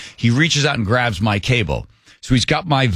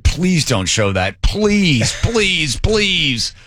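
pleading please, higher, slow; begging marketer; 5 semitones higher, much slower than usual. Retold or imitated. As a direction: beg them not to air it. Marketing department begging the show.

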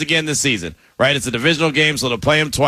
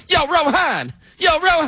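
high, far, brash "Yo, Rohan" like a Jersey guy; New Jersey guy; 12 semitones higher than usual. Pretend. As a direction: call out street style. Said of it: "I sound like I'm from New Jer".

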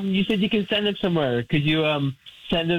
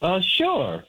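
ordinary talk

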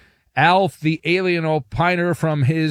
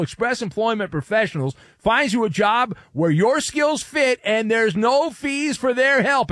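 upbeat sponsor read, pitched up; 6 semitones higher than usual. Acted. Host reading a sponsor ad in a pitched-up ad voice. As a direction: sell it like a radio spot.

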